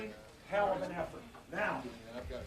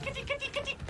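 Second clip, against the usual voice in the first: calling out high; 4 dB quieter, faster than usual. Acted. Calling a cat in ad.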